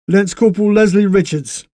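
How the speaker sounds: a proper English corporal; British soldier voice. Acted. Les introduces himself.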